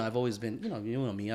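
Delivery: ordinary talk, nothing marked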